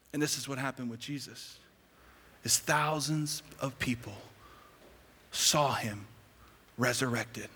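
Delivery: low, soft voice with long pauses; solemn hushed preaching. Real, not acted. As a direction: let each phrase land quietly. Preacher drops to solemn hush.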